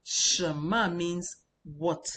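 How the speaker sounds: slow teaching. Shown as a demonstration. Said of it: "when you say shema".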